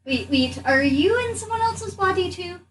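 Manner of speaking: ordinary talk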